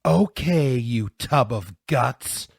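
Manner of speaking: loud, slow, menacing threat; snarling. A narrator's reading. Punched bully moving in on Louis, snarling. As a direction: drag the insult out with menace. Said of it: "he snarled".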